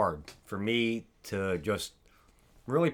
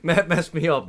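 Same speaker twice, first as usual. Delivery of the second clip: talking through real laughter; can't stop laughing; 3 semitones higher, 6 dB louder than usual. Real, not acted. Host blaming Matt for his slip, laughing. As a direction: blame him while cracking up. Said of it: "I can't stop laughing".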